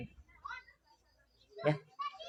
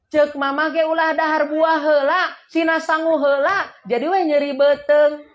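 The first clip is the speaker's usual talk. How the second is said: higher-pitched imitation of a nagging mother; mock scolding; 5 semitones higher than usual. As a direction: nag like a parent saying I told you so. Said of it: "Terus marahnya teh aneh". (omelan ibu yang ditirukan, nada tinggi)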